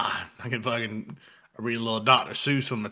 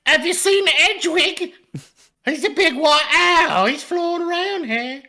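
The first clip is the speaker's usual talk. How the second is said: mock Harry Potter; 7 dB louder than usual. Acting out a bad audiobook Harry, high and loud. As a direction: squeak it like a tiny wizard.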